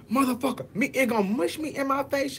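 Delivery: high-pitched imitation of someone cussing; angry aggressor. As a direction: mimic a hothead, voice jumping up high. Retold aggressor cursing and shoving.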